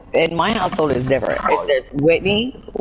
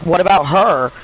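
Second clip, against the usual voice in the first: higher, louder mock protest; retold indignant deflection; 6 semitones higher, 5 dB louder than usual. Girl pointing at her friend, indignant mock protest. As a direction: imitate a kid passing blame, pitched up.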